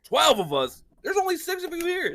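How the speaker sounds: incredulous Bjorn. Retold or imitated. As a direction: call out the lie in disbelief. Bjorn incredulous at Gandalf's count.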